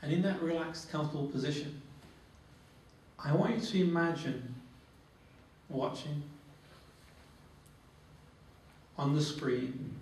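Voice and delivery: soft, calm hypnosis voice; guided relaxation. Acted. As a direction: ease the client down into trance. Trainer leads a relaxation induction.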